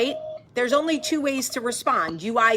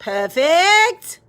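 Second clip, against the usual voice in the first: mock Australian accent; 5 semitones higher, 7 dB louder than usual. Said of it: "As is my Australian accent".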